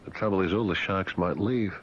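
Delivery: ordinary talk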